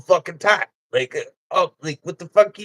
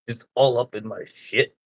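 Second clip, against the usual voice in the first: ordinary talk, nothing marked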